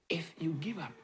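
hushed whisper of warning. Real, not acted. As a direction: drop to a whisper to warn them. Preacher drops to hushed warning.

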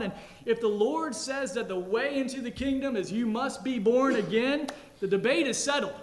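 loud, ringing pulpit voice; full-force preaching. Acted. Pastor declaring Christ's authority, loud preaching. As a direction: drive the point home to the church.

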